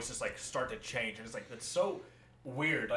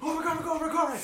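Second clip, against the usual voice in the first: very high, loud, breathless glee; ecstatic dog voice; 13 semitones higher, 6 dB louder, much faster than usual. Pretend. Dog told of a car ride, frantic excitement. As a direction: burst with joy like a dog.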